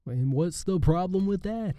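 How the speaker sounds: high, slow, stretched-out comedian's question; poor Jerry Seinfeld. Acted. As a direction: do a bad impression of a sitcom comedian. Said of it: "It's my poor impression of Jerry Seinfeld".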